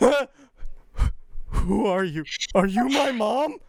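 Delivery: put-on high, lost baby-like voice; a bag searching for its mom.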